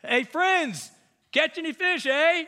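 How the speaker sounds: high, loud, playful call from the shore; hollering Jesus. Retold or imitated. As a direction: holler it out like a cheery stranger on the beach. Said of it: "Jesus hollers out".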